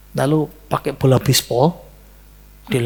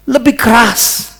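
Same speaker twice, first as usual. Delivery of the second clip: slow, loud and higher; firm command; 8 semitones higher, 6 dB louder, slower than usual. Retold or imitated. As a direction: order it harder, drawn out. Ayah menyuruh memukul lebih keras. (ayah memerintah pelan dan keras)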